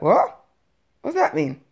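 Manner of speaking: loud, startled questioning; sharp jump in volume, taken aback; retold startled reaction. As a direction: re-enact a partner blurting in alarm. Partner's shocked reaction on phone.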